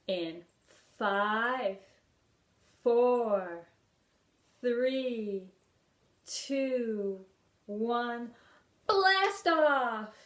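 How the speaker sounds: building countdown ending in a loud, high blast off. Shown as a demonstration. Teacher counting down the rocket for children, building to a loud shout. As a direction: count down with growing excitement, then launch. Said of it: "It goes".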